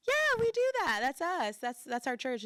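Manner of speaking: starts very high and loud, settles down; eager, fading to calm. Retold or imitated. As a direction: burst out eagerly then come back down. Her eager reply.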